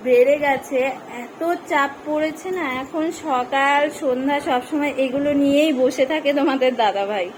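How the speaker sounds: loud voice carrying across rooms; calling out. Real, not acted. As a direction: call out to someone in another room. Calling loudly across the house.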